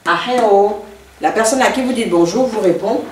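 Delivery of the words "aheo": AHEO louder and held from the middle; stress on middle syllable. Shown as a demonstration. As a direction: punch and stretch the HE of AHEO. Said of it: "avec l'accent tonique sur le".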